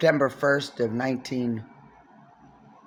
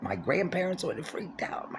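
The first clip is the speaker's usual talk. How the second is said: whispered aside; 3 semitones higher, 7 dB quieter than usual. Real, not acted. Aside about strict family.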